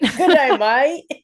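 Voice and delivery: a loud, playful fake Aussie "Good day, mate"; mock Australian. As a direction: join in with a cheeky Australian greeting. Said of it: "I'm not Australian".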